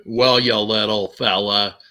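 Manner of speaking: slow attempt at a western film star; John Wayne. Shown as a demonstration. Cowboy addressing a little fella, in a slow imitated voice. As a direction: have a go at a cowboy actor, slowly. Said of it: "I tried to do John Wayne".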